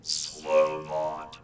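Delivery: processed machine voice; robot voice. Shown as a demonstration. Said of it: "So there's my slow bot".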